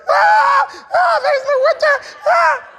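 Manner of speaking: loud high-pitched mock wail; hammed-up crying, wailing over the words; imitated crying. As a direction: bawl the words like a helpless child. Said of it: "Ishmael is crying".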